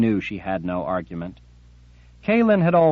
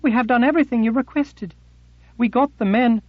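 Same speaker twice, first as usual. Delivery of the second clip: high, fast, frightened pleading; trembling voice; 6 dB louder than usual. Acted. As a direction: rush the excuse in high panic. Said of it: "in a trembling voice".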